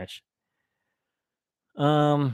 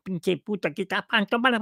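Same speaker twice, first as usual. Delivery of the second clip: mock yelling sister-in-law; 7 semitones higher, faster than usual. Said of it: "She kept yelling at me".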